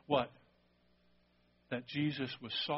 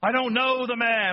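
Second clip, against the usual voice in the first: loud, higher denial; desperate denial; 8 semitones higher, 8 dB louder than usual. Retold or imitated. Peter shouting his denial at the servants, loud. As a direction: blurt it out in a panic. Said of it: "Peter goes crazy".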